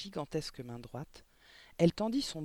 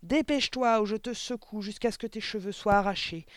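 raised, furious threatening harangue; thundering; 5 semitones higher, 4 dB louder than usual. A narrator's reading. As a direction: bellow threats at the pupil. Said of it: "tonna de nouveau mademoiselle Le Gourdin". (menaces tonnantes)

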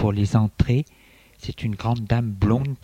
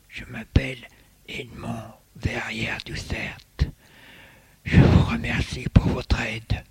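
put-on whispery old man; 6 dB quieter than usual. A narrator's reading. Old gentleman thanking the librarian.

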